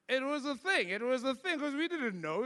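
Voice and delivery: ordinary delivery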